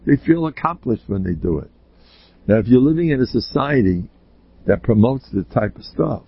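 ordinary talk, nothing marked

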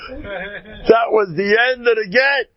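high, loud, slow proclamation; emphatic punchline. Real, not acted. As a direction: call the punchline out over laughter. Punchline called out over audience laughter.

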